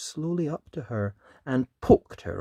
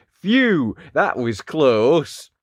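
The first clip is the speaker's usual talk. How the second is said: a slow, loud, voiced sigh of relief; grown-ups relieved after the dragon passes; 2 semitones higher, 9 dB louder, much slower than usual. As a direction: puff it out like frightened villagers. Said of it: "they'd say".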